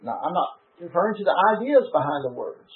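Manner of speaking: ordinary talk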